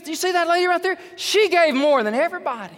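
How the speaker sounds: loud, high, acted amazement; excited exclaiming. Preacher as Jesus points out the giving widow, excitedly. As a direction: burst out with the big news. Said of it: "He got excited".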